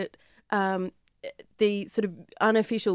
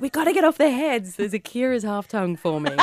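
surfer voice; 4 semitones higher, 5 dB louder than usual. Pretend. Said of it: "It's like".

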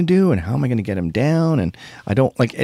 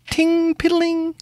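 imitated small noise; slower than usual. Imitating faint house noise.

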